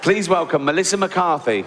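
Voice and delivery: ordinary talk, nothing marked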